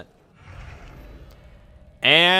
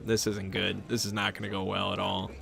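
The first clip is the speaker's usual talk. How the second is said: much softer, fast nervous mutter under the breath; worried mutter; 7 semitones lower, 14 dB quieter than usual. Retold or imitated. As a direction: mutter the dread to yourself, quick and low. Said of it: "and I'm like".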